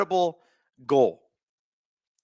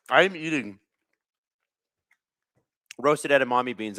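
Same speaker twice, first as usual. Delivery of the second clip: ordinary talk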